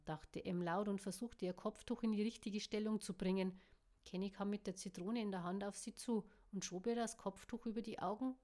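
ordinary delivery, nothing marked

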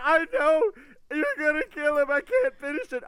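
high, loud furious yell; mad friend yelling. Retold or imitated. Friend on the phone yelling he can't finish. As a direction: scream it in outrage. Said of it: "He was so mad".

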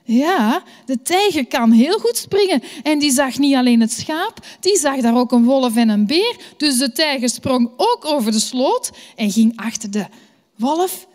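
usual speaking voice

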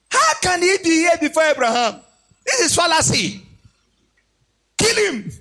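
high, jeering mob voices; high mocking taunt of the crowd. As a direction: sneer at the boy from Nazareth. Crowd mocking Jesus, threatening.

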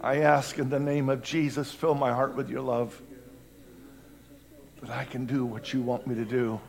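soft, low, earnest prayer; hushed prayer. Real, not acted. Believer asking Jesus to fill his heart, hushed prayer. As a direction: pray quietly and humbly from the heart. Subdued prayer after the outcry.

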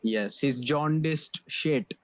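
normal talking voice

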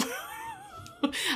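a high, broken laugh that tips into a sob; crying laugh. Real, not acted. Venting about doctors, a laugh that cracks into crying. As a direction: laugh through tears, way up high.

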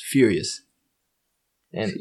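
ordinary delivery

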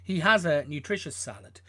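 ordinary talk

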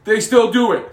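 high loud heel rant; heel wrestler mic rant. Retold or imitated. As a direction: yell at the crowd like a heel. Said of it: "healed at the crowd and was like".

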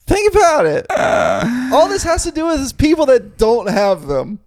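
laughing shout. Real, not acted. Laughing heated argument.

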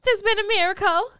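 put-on high, loud Derry girl accent; Northern Irish accent. Friend quoting the girl at the statue, high Northern Irish accent. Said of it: "could do some of the accents".

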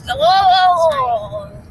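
long, loud swooping whoa; drawn-out yell as if jolted off the seat; startled whoa. Pretend.

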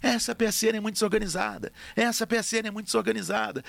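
high-pitched nagging complaint; repetitive, high mock yelling; Coppola's mock shouting. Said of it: "e o Coppola gritando".

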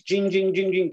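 high, quick sing-out of jangling keys; imitated jingling keys. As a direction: mimic keys jingling down a hallway. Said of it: "I hear these keys".

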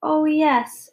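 mildly acted tearful dismay; about to cry. A narrator's reading. Daughter who stepped on the glasses, near tears. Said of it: "began to cry".

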